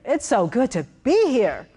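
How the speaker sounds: projected confident version; confident tone demo. Shown as a demonstration. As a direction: say it with power and presence. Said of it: "The other thirty percent is tone".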